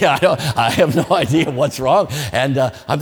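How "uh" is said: usual speaking voice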